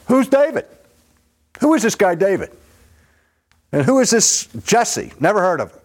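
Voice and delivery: high mocking sneer, stressing who; mock the name as if it is nothing, pitched high; insulting sneer. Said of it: "insulting David and David's father".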